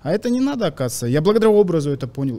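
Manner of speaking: ordinary talk, nothing marked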